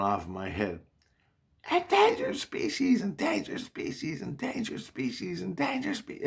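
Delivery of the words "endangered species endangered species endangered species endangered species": high, repeating alarm-like chant, mock screaming; imitated screaming alarm. Dream alarm screaming in his head during the fight, high siren chant. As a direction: wail it like a siren going off inside the skull. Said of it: "it's fucking screaming".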